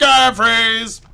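slow, high and loud sing-song; hammed-up goodnight to a viewer; drawn-out high goodnight. Goodnight chant escalating.

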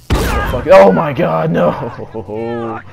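yelled despair; loud shocked no; horrified shout. Real, not acted. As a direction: cry out as you both go down. Both players killed.

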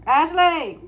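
high, loud drawn-out call. Pretend. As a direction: holler the name out high and long. Calling out Ashley with the toddler.

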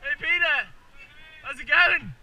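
loud high thrilled yelling; excited shout. Real, not acted. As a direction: shout it out with joy. Shouting to friend mid-fall.

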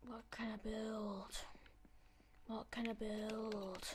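slow hushed whisper to himself; a slow, hushed whisper of wondering; whispered pondering. Retold or imitated. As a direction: breathe the question out, slowly.

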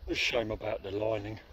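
normal talking voice

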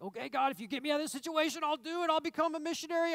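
hammed-up desperate deal with God; frightened prayer. Preacher mocking a foxhole prayer in a mild panic. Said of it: "this frightened prayer".

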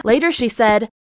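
ordinary talk

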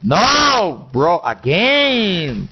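high, drawn-out exasperation; exasperated groan. Real, not acted. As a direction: drag it out, fed up. Killed once more.

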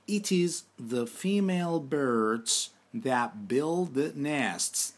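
slow, deliberate English sentence read for students; careful teacher dictation. Shown as a demonstration. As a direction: spell it out slowly so learners can repeat. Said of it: "Tekrar edelim".

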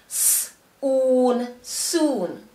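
hissed "s", long "oon", then "soon" joined; sounding out and blending. Shown as a demonstration. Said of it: "Did you hear the oo sound?".

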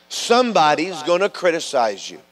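ordinary delivery

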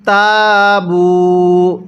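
তাবু with both vowels held one alif long; slow recited তাবু; stretched vowels. Shown as a demonstration. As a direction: draw out তাবু very slowly. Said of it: "তাহলে কিভাবে পড়ব?".

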